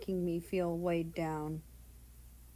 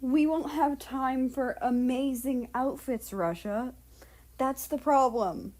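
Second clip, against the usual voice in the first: higher and louder, fretting; exasperated worry; 5 semitones higher, 4 dB louder than usual. Acted. Japan fretting to Russia about outfits, higher and louder. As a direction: push it out in a flustered rush. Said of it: "Japan exhaled".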